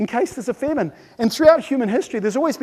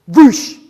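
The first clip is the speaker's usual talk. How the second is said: loud explosive rush of water; tsunami whoosh; 2 semitones higher, 12 dB louder than usual. Acted. Speaker imitating a tsunami hitting, loud whoosh. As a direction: burst out a sudden whoosh.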